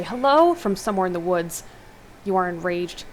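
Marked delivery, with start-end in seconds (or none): 0.0-0.5 distant call. A narrator's reading. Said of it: "barely hear someone say".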